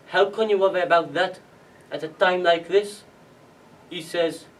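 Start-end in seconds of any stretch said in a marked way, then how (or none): 0.1-3.0 louder, higher quoted protest; raised-voice reproach; incredulous protest. Retold or imitated. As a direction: challenge him in disbelief. Challenging father in retold argument.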